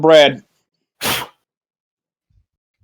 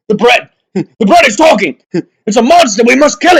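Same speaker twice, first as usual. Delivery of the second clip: mock alarm; 6 semitones higher, 5 dB louder than usual. Player panics at talking bread.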